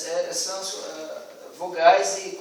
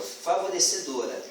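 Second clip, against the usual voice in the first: usual speaking voice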